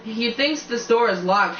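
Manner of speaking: plain reading voice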